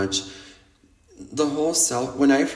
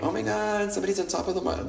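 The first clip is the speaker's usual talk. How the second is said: higher-pitched imitation of amazed onlookers; mock astonishment; 7 semitones higher, 4 dB quieter, faster than usual. Imitating clubbers spotting someone on the speaker, high mock amazement. Voices startled onlookers on phones.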